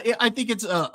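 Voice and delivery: ordinary talk, nothing marked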